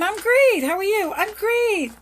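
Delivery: bright, high, beaming reply; cheerful I'm great. Retold or imitated. Answering a friend's greeting, beaming and high-pitched. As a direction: chirp it out like the best day ever. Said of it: "I say I'm great all the time".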